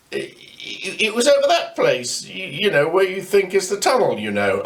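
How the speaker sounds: scared stammer. A narrator's reading. Boy explaining to Grandad, a mild nervous stammer. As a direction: stammer it out, unsure.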